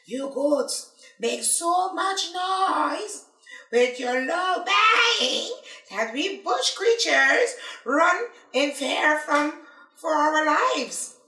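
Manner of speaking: slow, high, raspy little creature; raspy lizard voice. Acted. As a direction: drawl it like a small raspy lizard. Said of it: "A small raspy voice".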